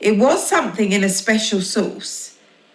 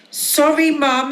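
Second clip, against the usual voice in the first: high hurried call; yelled; 8 semitones higher than usual. Acted. Girl yells sorry to mum while rushing. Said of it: "yelled Laura".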